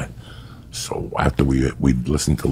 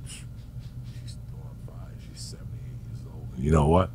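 confiding whisper; 10 dB quieter than usual. Retold or imitated. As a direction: lean in and whisper the secret. Friend confiding a dirty secret.

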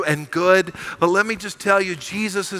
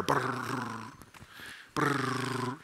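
low, soft rolled purring rumble; imitated big-cat purr, lower and softer; lion purr; 7 semitones lower, 14 dB quieter than usual. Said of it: "hear him purr".

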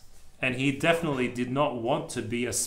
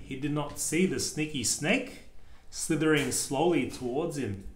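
plain reading voice

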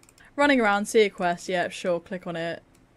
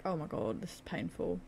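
ordinary delivery

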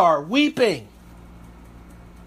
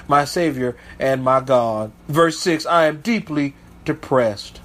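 lower, measured reading of the psalm; low, solemn scripture reading; 5 semitones lower than usual. Acted. A preacher reading David's lament low to his congregation.

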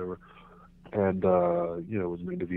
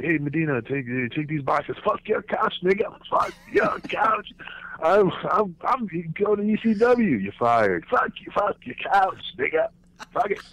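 high, loud Chappelle-style rant; Dave Chappelle; 11 semitones higher, 6 dB louder than usual. Retold or imitated. Quitting his job, mocking the boss Chappelle-style, loud and high. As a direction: yell it like Chappelle's fed-up character. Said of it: "I was like Dave Chappelle at work".

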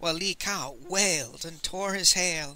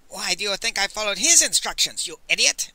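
higher, loud exasperated retort; raised-voice indignation; angry shout; 5 semitones higher, 5 dB louder than usual. A narrator's reading. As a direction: bawl at him for being stupid. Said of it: "Master Li shouted".

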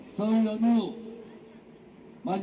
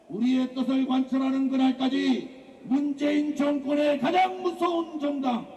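usual speaking voice

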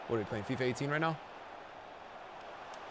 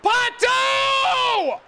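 high, loud, drawn-out yell; yelling a name; 6 dB louder than usual. Real, not acted. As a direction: call him across the pitch. Calling his player.

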